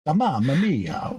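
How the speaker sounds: hammed-up Italian exclamation; mock Italian accent. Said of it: "two Italians with road rage".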